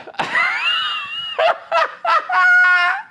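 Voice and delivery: long high-pitched cackling laugh; helpless laugh. Real, not acted. Streamer dying over a fart clip, helpless high laugh. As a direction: let a high, uncontrollable laugh run out. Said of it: "I'm dying over here".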